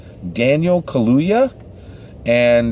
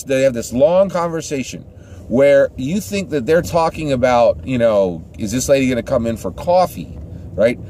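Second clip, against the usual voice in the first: ordinary talk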